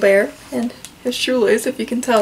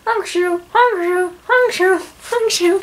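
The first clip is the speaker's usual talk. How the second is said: high, louder sing-song honk shoo snores; cartoon snoring; 9 semitones higher, 5 dB louder, slower than usual. Dinosaurs snoring in the board book, high cartoon snores. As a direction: snore it out playfully. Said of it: "Here's their snoring".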